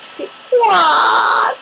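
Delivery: high, slow wailing quack with a cry in it; sad crying quack. Shown as a demonstration. Demoing a sad duck to her viewers, a high crying quack. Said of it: "quand t'es triste".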